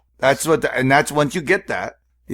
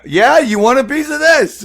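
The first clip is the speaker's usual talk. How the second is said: high, loud taunt in character; hammed-up fighting challenge; mock kung fu challenger; 12 semitones higher, 6 dB louder than usual. Bluffing three guys in a kung fu outfit, mock tough challenge. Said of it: "don't break the character".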